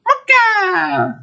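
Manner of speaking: very high squealed MOCHA, falling; little boy voice. Acted. Gamer imitating friend's voice, little-boy squeal. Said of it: "those little boy voices that's like".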